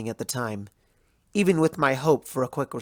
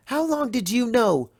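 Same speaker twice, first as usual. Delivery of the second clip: pained high question; 8 semitones higher than usual. Acted. Twilight pressing the princess, voice jumping higher. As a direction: voice jumps much higher on the repeat. Repeated accusing question, breaking.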